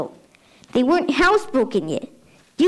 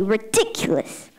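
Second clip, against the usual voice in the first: normal talking voice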